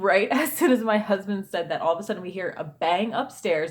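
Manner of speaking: ordinary delivery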